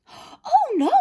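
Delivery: put-on high, loud little girl's voice. A narrator's reading. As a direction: answer brightly like a little girl. Girl answers wolf.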